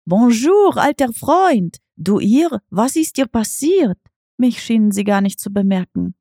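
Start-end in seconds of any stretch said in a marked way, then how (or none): 0.0-4.0 calling out glad. Acted. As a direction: call across the clearing in delighted surprise. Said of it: "rief Gaspar".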